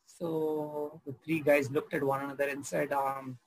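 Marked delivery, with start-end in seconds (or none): none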